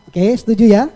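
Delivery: usual speaking voice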